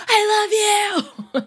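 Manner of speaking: high, slow, stretched mock yell of love; mock scream. Teacher acting out friends screaming love at each other, high and drawn out. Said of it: "So it's kinda like".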